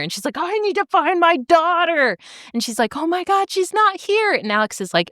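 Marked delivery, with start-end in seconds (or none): 0.4-2.2 high, pleading insistence; mock desperate mother. Lily insisting on going in for her daughter, mock desperate. 3.0-4.3 high, distressed retelling; mock panicked mother. Lily searching among dead bodies for daughter, mock panic.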